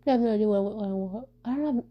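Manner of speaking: stumbling over repeated words; real stuttering. Real, not acted. Vlogger unsure what to say next, stuttering. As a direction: trip and restart, losing the thread. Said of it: "sorry I'm stuttering so much".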